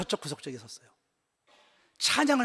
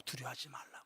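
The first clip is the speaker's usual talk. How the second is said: hushed whisper, lower and soft; 6 semitones lower, 18 dB quieter than usual. Retold or imitated. Pastor whispering God's comfort to the congregation. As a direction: breathe it like a holy secret. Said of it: "주님이 내게 말씀하시더라고".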